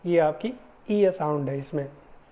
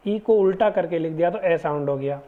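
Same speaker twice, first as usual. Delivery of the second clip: usual speaking voice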